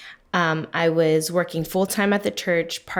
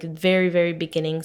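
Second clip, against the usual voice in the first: normal talking voice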